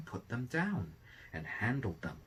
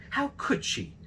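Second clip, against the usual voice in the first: higher and louder, outraged; indignant sparrows; 10 semitones higher, 6 dB louder than usual. A narrator's reading. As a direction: cry out in disbelief at her cruelty. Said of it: "they asked each other".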